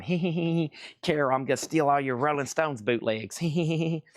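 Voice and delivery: lightly voiced sneaky gremlin, giggling; a mischievous little gremlin cackling over stolen records. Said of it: "That was the sound of the gremlins".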